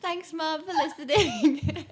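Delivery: laughing at the thought of mum listening. Real, not acted. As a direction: giggle the words out. Laughing mock thanks to mum.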